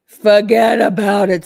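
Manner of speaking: loud, slow mock New York "Forget about it". Reading a viewer's line in mock New York accent. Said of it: "says Zach".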